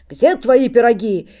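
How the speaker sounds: slow, booming demand; monk bellowing at the cook, slow and loud. Acted. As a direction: bellow for the pies. Monk demands pies shouting.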